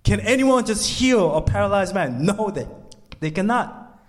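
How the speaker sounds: high, driving sermon delivery; impassioned preaching. Acted. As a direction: press the point home to the crowd. Preaching builds again.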